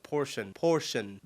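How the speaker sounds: Porsche imitated as 'portion'; added final N. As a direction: say portion, POR-shun, for the German name. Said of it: "sounds like she has an N on the end".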